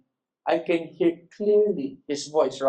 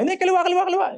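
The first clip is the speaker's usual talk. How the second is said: very high, urgent calling; mock crowd shouting misleading directions in high pitch; imitated rival onlookers; 11 semitones higher, much faster than usual. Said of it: "Yung iba". (matinis na panggagaya sa ibang nagtuturo ng direksyon)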